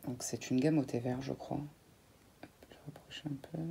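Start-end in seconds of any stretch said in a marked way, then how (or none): 2.4-3.6 quick hushed whisper to herself; whispered aside. Real, not acted. To her viewers while adjusting the shot, a quick whisper. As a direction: whisper it fast while moving the camera. Moving the product closer to camera.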